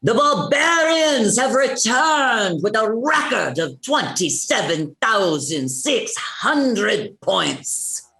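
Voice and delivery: big, drawn-out arena announcement; announcer yell. Acted. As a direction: yell it slowly to a whole crowd. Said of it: "so now you're yelling".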